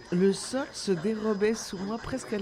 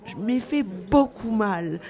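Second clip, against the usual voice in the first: put-on high, slow, louder child's wail; hammed-up tearful small child; mild acted sob; 4 semitones higher, 5 dB louder, slower than usual. A narrator's reading. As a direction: drag it out like a hurt little boy. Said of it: "sanglota le pauvre petit".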